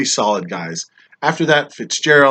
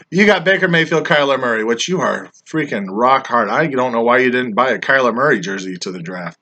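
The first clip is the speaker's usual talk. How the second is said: ordinary talk, nothing marked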